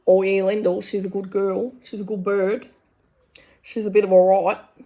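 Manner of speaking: a light mock blokey Aussie voice from the bush; lightly voiced laid-back country bloke sizing up his wife; Cam impression. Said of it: "Is that really how he talks?".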